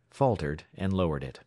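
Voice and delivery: ordinary delivery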